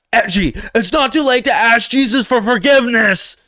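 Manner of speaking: high and loud mock-preachy shout; hammed-up shouting churchgoer; all-caps voice. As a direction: read a caps-lock comment as a booming sermon. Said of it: "That's what I imagine you sound like when you're using all caps".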